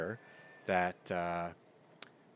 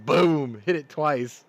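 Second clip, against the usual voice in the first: much louder, triumphant blast; 5 semitones higher, 12 dB louder, slower than usual. Real, not acted. Reliving the tank shot for listeners, loud and triumphant. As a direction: shout the hit.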